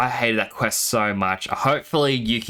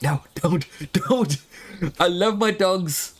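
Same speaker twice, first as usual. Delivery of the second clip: mock pleading; 6 semitones higher, slower than usual. Pleading with father not to feed dogs.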